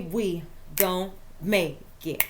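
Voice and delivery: slow, low hip hop chant of the line. Retold or imitated. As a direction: drag it out like a rap hook. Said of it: "that little [name] kiss for you".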